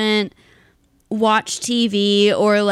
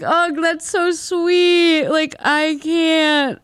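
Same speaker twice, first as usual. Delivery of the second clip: high, slow, gushing aww over the sweet video; 6 semitones higher, much slower than usual. Retold or imitated. Retelling her own teary reaction to a sweet video, gushing high and slow. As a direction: melt over it, drawn out and high. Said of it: "it was like".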